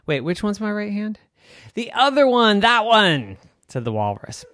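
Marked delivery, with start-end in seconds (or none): 0.1-3.4 high character voice. Acted. Girl and walrus.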